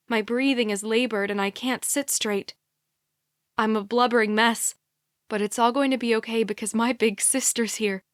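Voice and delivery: ordinary delivery, nothing marked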